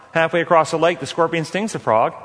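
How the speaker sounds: ordinary talk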